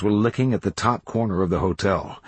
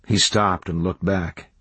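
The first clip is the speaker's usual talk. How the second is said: usual speaking voice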